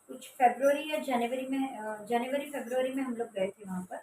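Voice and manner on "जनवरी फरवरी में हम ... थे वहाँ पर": normal talking voice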